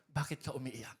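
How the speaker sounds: soft, low-pitched gentle question; quietly voicing a caring Lord; tender divine question.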